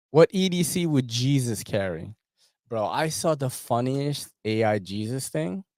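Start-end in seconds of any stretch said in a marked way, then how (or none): none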